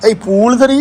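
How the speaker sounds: high, loud excited call; excited shout. Real, not acted. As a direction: burst out in delight. Excited at seeing the pool.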